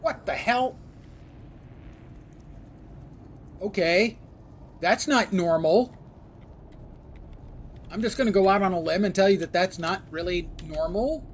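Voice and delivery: real shock at a weird bug; surprised. Real, not acted. Sees a strange visual bug.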